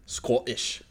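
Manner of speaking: one word in a mock Scottish accent. Shown as a demonstration. Friend tests saying the country, Scottish accent. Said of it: "in a Scottish accent".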